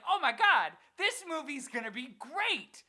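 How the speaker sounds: excited gush. Retold or imitated. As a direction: burst out like a thrilled kid about a new movie. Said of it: "I was like".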